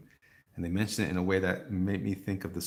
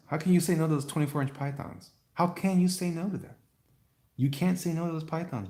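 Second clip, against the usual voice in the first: excited hype; 8 semitones higher, 5 dB louder than usual. Real, not acted. Hyping Hulk Hogan's arms.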